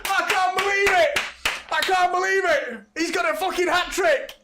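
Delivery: screaming high and loud in celebration; real, full-voiced football fan frenzy; ecstatic goal scream. Real, not acted. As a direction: lose it completely as the goal goes in. Celebrating a live hat-trick goal.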